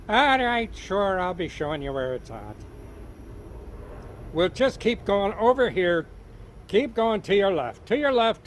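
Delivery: loud, high Irish leprechaun giving directions; relenting leprechaun. Acted. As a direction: point the way as the wee leprechaun. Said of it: "the leprechaun relented".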